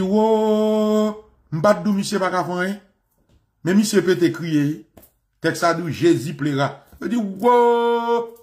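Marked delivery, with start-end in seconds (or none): 0.0-1.1 drawn-out mock wail. Jesus weeping, acted in sermon. 7.1-8.4 drawn-out mock wail. Jesus weeping, acted again.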